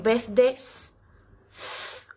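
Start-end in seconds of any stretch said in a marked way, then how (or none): 1.5-2.1 unvoiced Z, θ, whispered; just the θ, whispered; whispered th sound. Shown as a demonstration. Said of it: "pronunciar la S como una Z". (Z susurrada, θ)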